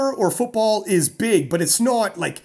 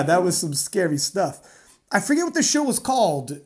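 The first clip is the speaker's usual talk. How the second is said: ordinary delivery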